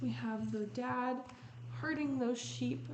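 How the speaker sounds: ordinary delivery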